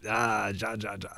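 soft, strange mock cackle; quiet, weird put-on laugh; imitated creature-like laugh. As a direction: imitate an odd alien chuckle under your voice. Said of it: "looked like a Star Wars character".

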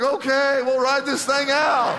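laughing it off in relief; a passenger's relieved laughing sigh. Retold or imitated. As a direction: chuckle through it, the danger is over. Passengers relieved after pilot's news.

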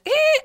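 tiny high-pitched "eh"; imitated squeal. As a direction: mimic a child's short high squeak. Imitating daughter's reaction on walking in.

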